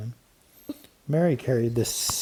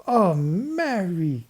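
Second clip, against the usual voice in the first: slow, much higher, stretched-out wonder; awed cry; 8 semitones higher, 4 dB louder, much slower than usual. A narrator's reading. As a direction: draw it out in amazement. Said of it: "cried Babs".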